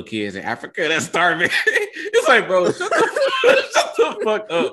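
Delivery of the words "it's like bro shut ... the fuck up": shrieking high laughter through the words; can barely get it out for laughing; laughing hard. Real, not acted. To his co-host about comment-section guilt trips, breaking into high laughter. Cracking up over the starving-kids line.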